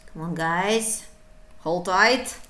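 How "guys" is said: ordinary talk, nothing marked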